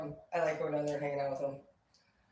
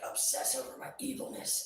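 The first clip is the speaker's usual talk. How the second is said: whispered villain taunt; mock villain whispering a taunt; sinister whisper; 6 semitones higher, 9 dB quieter than usual. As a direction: hiss it like a gleeful evil troll. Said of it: "They're like".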